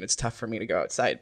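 ordinary delivery

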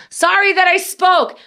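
high-pitched mock apology; sarcastic retort. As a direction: snap back in a high, sarcastic voice. Sarcastic retort to mother, retold.

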